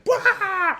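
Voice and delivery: sudden high-pitched ghostly wail; ghost's high wail. Retold or imitated. Retelling the ghost story, a high ghost wail. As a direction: shriek like a spooky little ghost.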